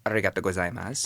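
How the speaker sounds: quick, showy Japanese arigato gozaimasu; put-on Japanese accent. Tourist thanking in Japan, put-on Japanese accent. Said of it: "my version of".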